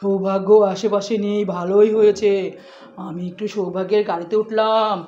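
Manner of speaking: sneaky intruder gloating quietly in the car; sneaky low voice. Pretend. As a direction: sneak in like a little villain. Sneaky character climbing in car.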